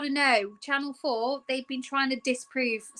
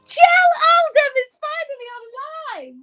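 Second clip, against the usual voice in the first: excited gasp; 8 dB louder, slower than usual. Real, not acted. Greeting a viewer joining.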